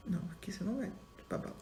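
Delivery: firm hushed warning. Retold or imitated. Teacher forbidding the candy.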